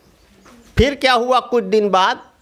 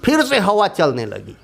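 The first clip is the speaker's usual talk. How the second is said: usual speaking voice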